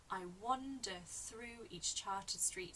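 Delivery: "I wander through each charted street" slow and evenly measured; strict rhythm. Shown as a demonstration. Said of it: "a very strict rhythm".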